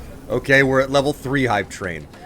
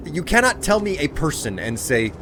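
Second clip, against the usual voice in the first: ordinary talk, nothing marked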